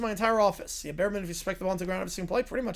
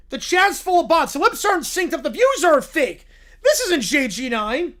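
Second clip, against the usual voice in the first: alarmed; 6 semitones higher, 8 dB louder than usual. Acted. Realizing the scam.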